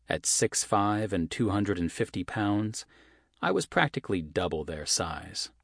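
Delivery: ordinary delivery, nothing marked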